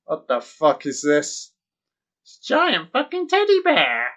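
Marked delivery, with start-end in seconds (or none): 2.2-4.1 excited squeal. Real, not acted. Shrieks at giant teddy bear.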